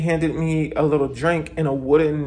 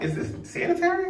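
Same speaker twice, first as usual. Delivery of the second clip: skeptical squeal; 13 semitones higher, 4 dB quieter than usual. Retold or imitated. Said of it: "For me, I'm like".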